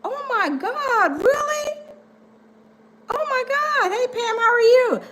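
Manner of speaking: a hammed-up startled hello to Pam; mock shocked greeting. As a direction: gasp it out then greet Pam sweetly. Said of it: "And I'm like".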